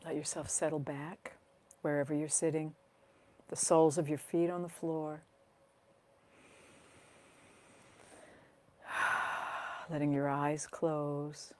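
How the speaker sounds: guided meditation. Acted. Teacher settles listeners into grounding exercise, calm guided meditation. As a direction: guide them gently into their body, phrase by phrase.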